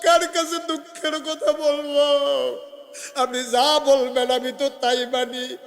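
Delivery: high, loud wailing plea; tearful pleading. Acted. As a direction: plead desperately as the weeping woman, in sermon chant. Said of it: "মহিলা কান্দে আর কয়". (কান্নার সুরে উঁচু গলায় আকুতি)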